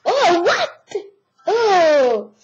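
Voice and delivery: "Oh, what?" lancé plus haut et plus fort dans un éclat de rire; cri de surprise qui part en rire; surprise avec un rire. Real, not acted. Surpris par ce qui arrive dans le jeu. (surprise réelle, rire étouffé)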